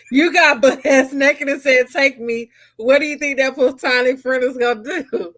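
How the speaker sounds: reading on through real laughter; laughing. Real, not acted. Host reads a racy listener question to friend, laughing. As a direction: get the question out while laughing.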